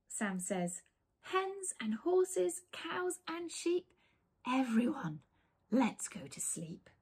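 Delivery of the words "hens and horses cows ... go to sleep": slow, higher lullaby, ending whispered; soothing. Acted. Sam soothing the animals to sleep. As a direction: lull them down to sleep.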